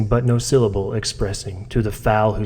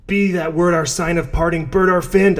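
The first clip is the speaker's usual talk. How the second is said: much higher shriek of defiance; 8 semitones higher than usual. Acted. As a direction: leap up and shriek at the bird. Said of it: "I shrieked upstarting".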